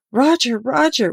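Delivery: high, slow anguished calling; frantic screams. Acted. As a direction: scream for him in horror. Veronica running, screaming.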